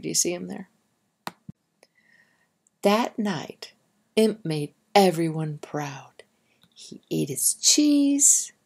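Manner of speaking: gentle picture-book storytelling; reading to children. Acted. Teacher reading a mouse story to the class, gentle storytelling. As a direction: read it like story time for little ones.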